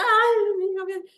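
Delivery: high wailing ay, half-acted tears; a touch of a sob over her daughter; mock crying. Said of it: "me iba a llorar". (lamento agudo, medio llorando)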